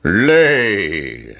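long drawn-out command from a giant; giant's shout. A narrator's reading. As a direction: order it like a giant, stretching the word. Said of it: "shouted the giant".

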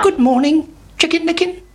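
high chirpy hen greeting. A narrator's reading. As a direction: greet like a bright little hen. Henny Penny greets Chicken Licken.

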